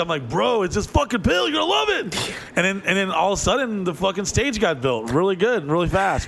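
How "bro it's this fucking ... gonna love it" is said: high-pitched eager bro sales push; excited, climbing higher to the end; hyped-up pitch. Retold or imitated. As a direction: imitate yourself talking a buddy into it, voice shooting up. Acting out pushing pills on dude.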